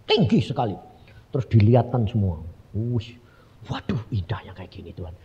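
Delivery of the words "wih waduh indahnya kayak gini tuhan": low, whispery amazement; hushed awe. Retold or imitated. Shown the sights of heaven by God, awed whisper. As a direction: breathe it out softly in wonder.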